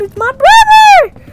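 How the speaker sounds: high, loud delighted cry; thrilled to spot him; excited. Real, not acted. Gamer spotting his brother in game, high excited shout.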